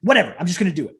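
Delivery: exasperated burst, then letting go; mock "oh, whatever" outburst; throwing-caution retort. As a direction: imitate someone giving up and going for it. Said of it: "they just go".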